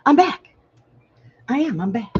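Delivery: high, loud "I'm back"; cheery announcement. Real, not acted. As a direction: announce your return brightly. Returning to viewers.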